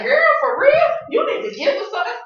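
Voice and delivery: put-on high, loud excited girl talk; hyped girlfriend. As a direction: squeal it like an eager homegirl. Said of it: "she like".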